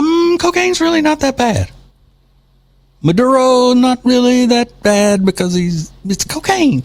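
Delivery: high mock-innocent tone, making light of cocaine. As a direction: squeak it out like a naive apologist. Mocking the reporter's defense of cocaine.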